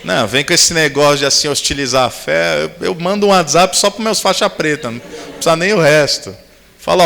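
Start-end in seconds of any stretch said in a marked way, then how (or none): none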